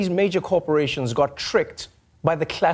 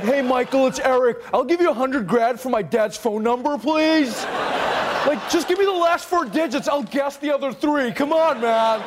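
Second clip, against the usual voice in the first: high, whiny begging voice; desperate mock begging; pleading son; 7 semitones higher than usual. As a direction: plead in a high-pitched, needy voice. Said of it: "He was like".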